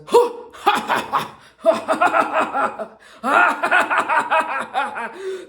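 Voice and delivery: loud high cackling laugh; manic laugh. Retold or imitated. Old man on airport shuttle mocking her jacket, manic laugh. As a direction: cackle like a delighted maniac. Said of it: "It was like a manic laugh".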